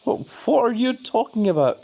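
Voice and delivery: high, incredulous what are you on about; exasperated disbelief; baffled. Real, not acted. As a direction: sound completely lost by the chat. Baffled by chat claim.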